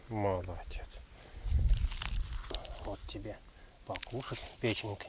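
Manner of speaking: quiet, tender treat offer; gentle reward for the dog; soft praise. Real, not acted. Похвала, угощение.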